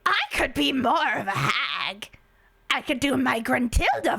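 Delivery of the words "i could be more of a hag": slow, hammed-up hag shout. Streamer answering chat with a mock hag yell. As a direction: crank up the witchy croak. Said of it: "You sound like a hag".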